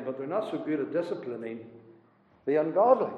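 ordinary delivery

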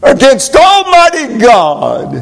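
rising to a preached shout; preaching at full force. Acted. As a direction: build it up and shout. Preaching climax.

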